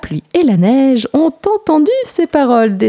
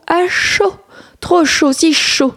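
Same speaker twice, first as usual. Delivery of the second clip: too hot; 9 semitones higher, slower than usual. A narrator's reading. Lucas overheated.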